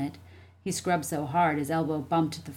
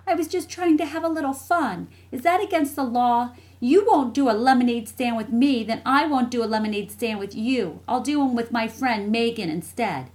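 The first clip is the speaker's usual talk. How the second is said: put-on higher, louder child character, indignant; indignant little sister; 7 semitones higher, 6 dB louder than usual. Jessie to her brother over a lemonade stand, indignant. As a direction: argue back like an offended kid sister.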